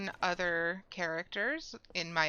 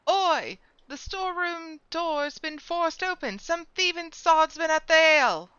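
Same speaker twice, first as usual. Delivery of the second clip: higher, loud barking guard; angry guard; 9 semitones higher, 7 dB louder than usual. Acted. Port guard yells about the robbed storeroom, loud and high. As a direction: bellow like an outraged port guard.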